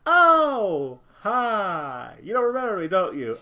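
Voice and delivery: high, loud, sing-song mock friendliness; fake cheerful greeting. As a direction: greet them falsely bright.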